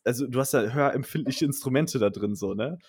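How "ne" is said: ordinary delivery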